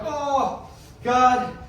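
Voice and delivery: high drawn-out groan of despair; mock dismay. Panicking caller hearing his calm friend, mock dismay. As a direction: wail it in comic anguish.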